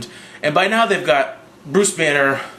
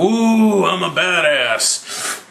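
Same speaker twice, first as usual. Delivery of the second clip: drawn-out, higher-pitched taunt; hammed-up bragging with stretched words; mock boasting; 5 semitones higher, much slower than usual. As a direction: show off mockingly, slowly. Mocking Loki's boasting.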